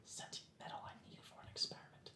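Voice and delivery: barely audible, breath-only whisper; furtive whisper. Acted. Explaining the experiment while hiding, furtive whisper.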